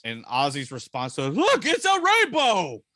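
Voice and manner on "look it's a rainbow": high, loud and slow cry of wonder; excited exclaim. Retold or imitated. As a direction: shout it up high like a thrilled kid. Quoting [name]'s excited line.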